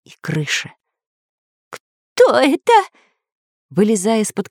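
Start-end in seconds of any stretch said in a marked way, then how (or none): 1.7-2.7 high, slow squeaky question; alarmed spy. A narrator's reading. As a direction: squeak it timidly from under the bed. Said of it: "спросил шпион Дырка". (писклявый вопрос шпиона)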